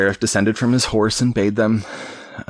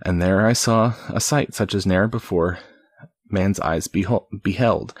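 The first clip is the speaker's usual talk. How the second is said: ordinary delivery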